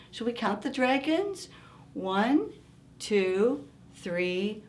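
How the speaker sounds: ordinary delivery, nothing marked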